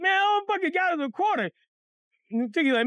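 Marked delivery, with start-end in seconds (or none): 0.0-1.5 high, whiny mock crying; tearful complaint. Boy shows his friends the quarter, tearful outrage. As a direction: wail it like a cheated kid. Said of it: "Why you crying?".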